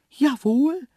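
very high, loud little voice; dainty cheeky creature. A narrator's reading. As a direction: answer as a dainty, cheeky antelope. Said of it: "war das eine zarte Stimme". (zarte, freche Antilopenstimme)

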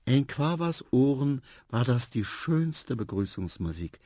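plain reading voice

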